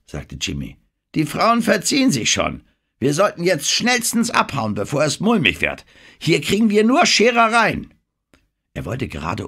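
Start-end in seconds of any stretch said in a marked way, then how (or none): 1.1-7.9 hoch, laut und alarmiert; dränge aufgeregt zur Flucht; aufgeregtes Drängen. Acted. (panisch drängend, mit hoher, lauter Stimme)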